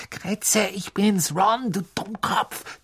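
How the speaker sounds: höher, langsam zischend; gezischt. A narrator's reading. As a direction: zisch die Ratte an. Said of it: "zischte Ron". (Ron zischt seine Ratte an)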